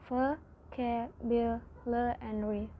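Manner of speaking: "ve, ke, bil, le, and ri" each syllable set apart. Shown as a demonstration. Student teaching the class, splitting "ve, ke, bil, le, and ri" into separate syllables. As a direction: count out vocabulary syllable by syllable. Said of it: "Vocabulary has five syllables".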